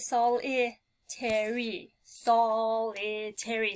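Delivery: solitary drawn out slowly, syllables separated; slow syllable read. Shown as a demonstration. Teacher slowly reading solitary to find the syllables. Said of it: "you would have to break this one".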